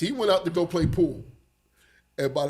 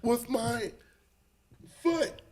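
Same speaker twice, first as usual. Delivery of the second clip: slow, high-pitched mock bafflement; baffled; 5 semitones higher, 3 dB quieter, slower than usual. Retelling his sore foot to friends, baffled. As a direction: draw out each word in retold disbelief.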